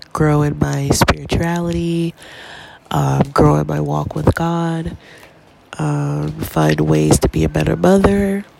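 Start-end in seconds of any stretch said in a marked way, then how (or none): none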